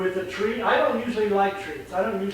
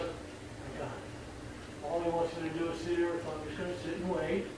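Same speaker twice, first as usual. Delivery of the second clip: calm dog thinking aloud; 3 semitones lower, 13 dB quieter than usual. Retold or imitated. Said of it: "To where he finally goes".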